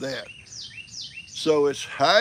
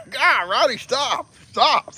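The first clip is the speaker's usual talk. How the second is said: high, loud laughing stop-it; cracking up while telling Ronnie to stop; laughing protest; 11 semitones higher, 9 dB louder, slower than usual. Real, not acted. Teasing Ronnie about sucking crawfish heads, laughing loud.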